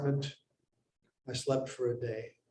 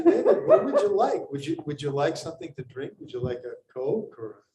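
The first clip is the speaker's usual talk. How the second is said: grandmother's friend; 3 dB louder than usual. Retold or imitated. Grandmother's friend offering David a drink, mock sweet old lady. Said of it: "they'd say".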